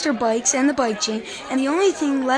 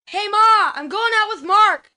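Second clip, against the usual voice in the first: high, loud call across the house; teen son hollering to his mom that he's heading out; 7 semitones higher, 6 dB louder than usual. Acted. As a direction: yell it so Ma hears from her room. Calling to mom in another room.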